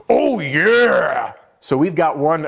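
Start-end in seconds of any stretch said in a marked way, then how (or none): 0.1-1.3 gravelly wrestler growl, loud and high; Randy Savage. Retold or imitated. Sales coach imitating a wrestler's catchphrase, loud excited growl. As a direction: belt it like a pumped-up pro wrestler. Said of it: "Randy Savage, the wrestler".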